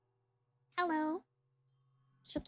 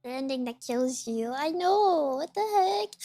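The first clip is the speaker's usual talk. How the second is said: usual speaking voice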